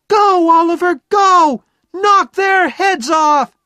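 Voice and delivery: very high, full-force cheering yell; shouting over crowd. A narrator's reading. Jenny urges Oliver on from the crowd, shouting. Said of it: "Jenny's voice above the crowd".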